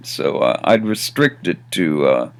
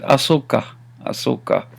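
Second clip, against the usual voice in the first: offhand, trailing off softer; very casual; 3 semitones higher, 4 dB quieter than usual. Shown as a demonstration. Teacher shows learners how it can be said, very casually. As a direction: toss it away lightly. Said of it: "very casually".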